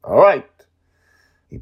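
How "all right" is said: much higher, louder cheerful reply; happy, upbeat agreement; happy all right. Acted. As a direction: say it gladly, brightening up. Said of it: "in a happy tone".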